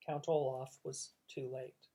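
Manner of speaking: ordinary delivery, nothing marked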